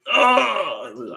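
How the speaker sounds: high, loud hammed-up wail; dying man's cry. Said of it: "as Saint Saturn is dying, he's like".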